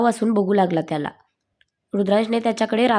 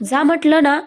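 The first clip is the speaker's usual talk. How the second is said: higher, louder angry yell; angry shout; 6 semitones higher, 4 dB louder than usual. A narrator's reading. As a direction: shout her out of the room. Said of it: "Rudransh ओरडूनच म्हणाला". (रागाने ओरडून म्हणणे)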